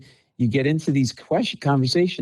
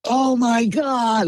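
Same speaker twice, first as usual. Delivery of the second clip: drawn-out higher mock scream of shock; audience shock; 12 semitones higher, much slower than usual. Audience reacting to a shocking story, mock scream. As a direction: mimic a stunned crowd gasping it out slowly. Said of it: "they're screaming".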